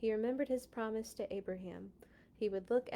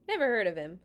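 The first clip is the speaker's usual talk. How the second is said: dismissive Pharaoh; 7 dB louder, much faster than usual. Acted. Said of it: "said Pharaoh".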